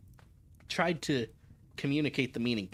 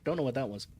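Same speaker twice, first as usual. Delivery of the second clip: plain reading voice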